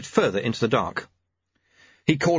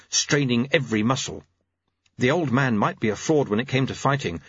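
ordinary delivery

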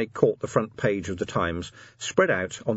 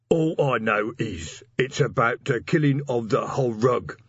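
slightly raised, sullen troll voice; surly troll mumble; 3 semitones higher than usual. A narrator's reading. Cornered troll messenger answers the officers, surly and mumbling. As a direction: take refuge in surliness as a troll. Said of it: "mumbled the messenger, taking refuge in surliness".

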